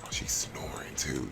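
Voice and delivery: soft unvoiced whisper. Real, not acted. Whispering so the sleeping sim stays asleep. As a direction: breathe it so nobody wakes.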